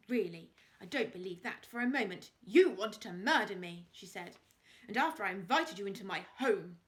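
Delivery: loud, indignant outrage; wife outraged at her attacker. A narrator's reading. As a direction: let the outrage ring out. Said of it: "looked outraged".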